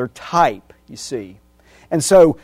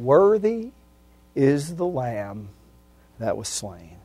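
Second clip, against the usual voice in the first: reverent praise; 7 dB quieter, slower than usual. Pretend. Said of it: "We're gonna be saying".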